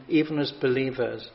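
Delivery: ordinary talk, nothing marked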